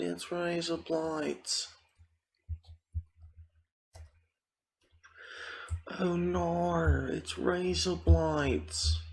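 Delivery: very high, drawn-out mock Australian squeal; Australian accent. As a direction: wail slowly in a hammed-up Australian accent. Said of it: "I was trying to do an Australian accent".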